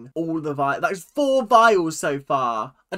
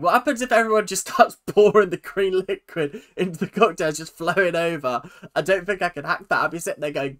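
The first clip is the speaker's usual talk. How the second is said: ordinary talk, nothing marked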